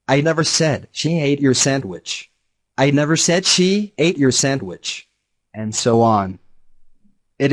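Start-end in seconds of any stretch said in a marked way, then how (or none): 0.1-2.3 "never" punched, the rest lighter; stress on never. Shown as a demonstration. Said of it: "depending on which word the speaker accents". 2.8-5.1 "she" stressed and lifted; single out "she" as the one accused. Shown as a demonstration. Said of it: "depending on which word the speaker accents".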